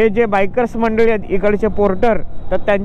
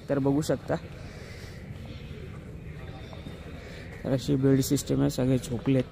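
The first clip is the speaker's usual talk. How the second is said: hushed whisper so sleepers stay asleep; 7 semitones lower, 15 dB quieter than usual. Real, not acted. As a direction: keep it to a quiet whisper. People asleep in tent at night.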